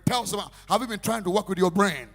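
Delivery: high, very loud, slow shouted preaching; slow roaring sermon peak; climactic preaching. Real, not acted. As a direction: drag out the climax in a huge shout. Preaching at full force.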